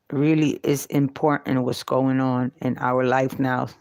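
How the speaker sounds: ordinary talk, nothing marked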